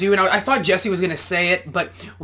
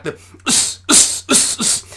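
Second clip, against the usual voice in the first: sharp breathy "us" grunts; boxer's short exhale on each punch; 5 semitones higher, slower than usual. Shown as a demonstration. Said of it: "everybody talks about that".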